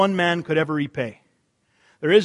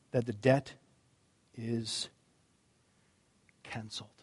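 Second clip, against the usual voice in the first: hushed reveal; 7 semitones lower, 11 dB quieter than usual. Real, not acted. Pastor's dramatic reveal, fading.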